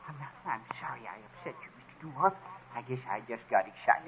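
hushed, sheepish apology; soft and contrite; chastened apology. Acted. Chastened after being scolded for shouting.

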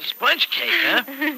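high, teasing "sponge cake, huh"; playful high disbelief; amused surprise. Acted. Husband teasing about the cake.

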